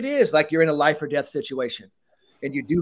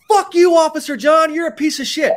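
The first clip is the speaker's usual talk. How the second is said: high, loud mock insult yelled at a cop; mock furious shout; hostile yell; 12 semitones higher, 7 dB louder than usual. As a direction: yell it like an angry citizen cursing an officer. Role-play of hostile citizen.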